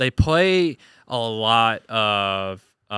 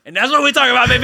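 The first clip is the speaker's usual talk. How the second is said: excited; 11 semitones higher, 4 dB louder, faster than usual. Real, not acted. Hyped about rap.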